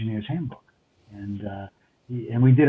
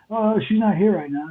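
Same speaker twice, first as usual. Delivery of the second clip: imitating the assistant engineer; 9 semitones higher than usual. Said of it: "pops out and goes".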